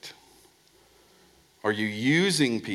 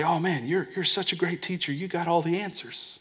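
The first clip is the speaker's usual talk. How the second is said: higher fawning imitation; lightly voiced sycophant; mock flatterer; 7 semitones higher than usual. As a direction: gush like a flatterer. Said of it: "And they say".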